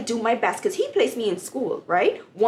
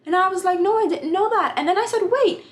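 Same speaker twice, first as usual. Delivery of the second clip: much higher and louder, thrilled; excited disbelief; 11 semitones higher, 4 dB louder than usual. Retold or imitated. Student learning she has the top grade, excited and high. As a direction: squeal it in shocked delight. Shocked exchange with professor.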